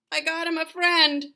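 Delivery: ordinary delivery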